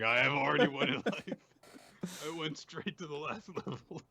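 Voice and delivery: a mock triumphant boast that breaks into a laugh; smug gloating. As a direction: brag about winning at life, half laughing. Rat gloating, echoing partner.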